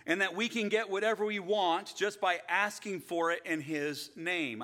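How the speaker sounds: high, loud preaching at full force; preacher warning against false teaching, loud; fiery preaching. Acted. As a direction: drive the point home from the pulpit.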